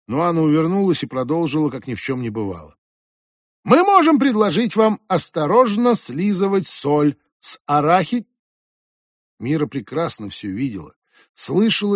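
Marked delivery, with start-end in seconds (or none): 3.6-8.3 flight attendant voice. Acted. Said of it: "голосом стюардессы произнесла".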